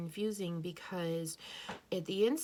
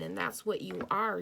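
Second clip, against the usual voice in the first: usual speaking voice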